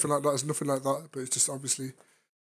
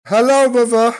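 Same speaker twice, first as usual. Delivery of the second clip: much higher and louder hello to mother; loud greeting; 8 semitones higher, 14 dB louder, slower than usual. Real, not acted. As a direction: call out over the room.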